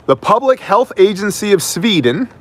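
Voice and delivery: high-pitched put-on Swedish accent; mock Swedish accent, lifted pitch. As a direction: begin the quote in a playful Swedish accent. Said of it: "not gonna read this in a Swedish accent".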